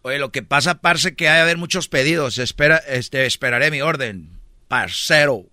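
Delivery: low-pitched Colombian customer; imitated Colombian accent, deep. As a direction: grumble low like a Colombian diner. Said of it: "un colombiano".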